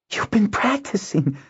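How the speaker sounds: very high, thrilled exclamation; excited, high-pitched pleasure; delighted. A narrator's reading. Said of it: "Nuella exclaimed delightedly".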